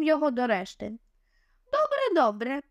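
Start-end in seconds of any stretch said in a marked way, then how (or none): 1.7-2.6 squealing villainess, jumping high; shrill squeal. A narrator's reading. Oblivia squealing from behind the Egyptian, a hint of villainy. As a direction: shriek it out from behind him. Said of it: "верескнув ... голос Облівії".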